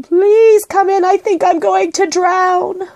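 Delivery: high, drawn-out panicked wail; pleading shout. A narrator's reading. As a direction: plead loudly and slowly for rescue. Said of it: "shouted Paddington".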